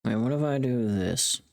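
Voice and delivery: ordinary delivery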